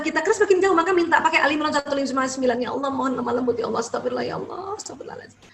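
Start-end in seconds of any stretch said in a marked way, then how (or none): 2.6-5.4 prayer sinking to a whisper; soft prayer. Shown as a demonstration. Mother praying for her lapsed child, fading to a whisper. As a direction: pray softly, fading into a whispered istighfar.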